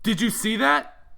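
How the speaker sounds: high, loud, slowed startled cry; alarmed shout. Acted. Jack to Damien after a bullet grazes him, loud startled cry. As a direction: blurt it out, shaken by the near miss.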